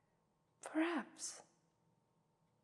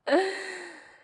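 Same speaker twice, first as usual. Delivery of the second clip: loud, high cackling laugh; evil laugh; 10 semitones higher, 9 dB louder than usual. Acted. Vampire cackling at the captive mortal, evil laugh. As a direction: burst into a gleeful villain laugh.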